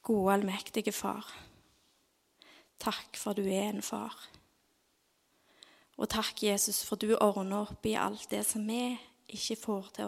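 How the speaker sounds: soft, hushed closing prayer; softly spoken prayer to Jesus; quiet prayer. Acted. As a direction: pray gently, barely above a whisper.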